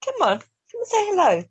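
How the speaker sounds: high, sing-song coaxing; high coaxing call to a cat; coaxing pet voice. Real, not acted.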